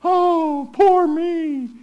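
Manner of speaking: high, loud whiny "poor me"; mock self-pity. Preacher mocking the self-pitying believer, whiny and high. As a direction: whine it like a spoiled child. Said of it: "He would want you to say".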